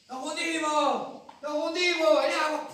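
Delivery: high, loud terrified yells; frantic cries over the storm; panicked shouting. Acted. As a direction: scream it like the boat is sinking. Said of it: "que están todas gritando".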